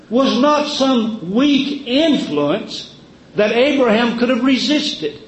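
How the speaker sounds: usual speaking voice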